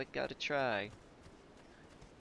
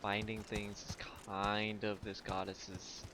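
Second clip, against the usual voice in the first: normal talking voice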